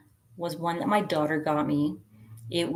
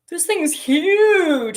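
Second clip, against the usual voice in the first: high, slow, drawn-out dismay; shocked friend; 11 semitones higher, 6 dB louder, slower than usual. Retold or imitated. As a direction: stretch it out like a stunned friend.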